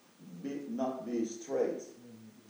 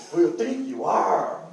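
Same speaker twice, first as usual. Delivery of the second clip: mock challenge; 7 semitones higher, 12 dB louder than usual. Retelling others' challenge.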